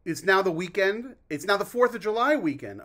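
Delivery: upbeat host voice; excited announcement. Real, not acted. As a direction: announce it like a holiday is here.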